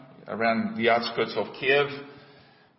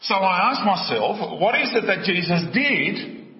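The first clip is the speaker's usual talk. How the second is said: higher-pitched, urgent rhetorical questions; impassioned preaching; 7 semitones higher than usual. Acted. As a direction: press the congregation with incredulous questions. Preacher's run of indignant questions.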